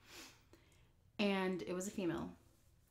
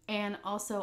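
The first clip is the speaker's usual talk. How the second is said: ordinary talk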